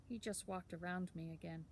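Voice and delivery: ordinary delivery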